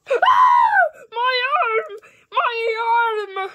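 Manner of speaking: high, loud mock cry of pain; hammed-up pain cry. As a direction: wail over the hurt arm. Toy hurt by kitty.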